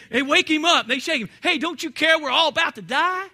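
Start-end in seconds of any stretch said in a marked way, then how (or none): 0.1-0.9 loud, urgent cry to wake him; frightened disciples yelling; panicked shout. Acted. 1.4-3.3 loud, urgent cry to wake him; frightened disciples yelling; panicked shout. Acted.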